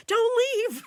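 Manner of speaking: put-on high, loud, laughing kid plea; kids pleading. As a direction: squeal it like begging kids. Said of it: "They wouldn't".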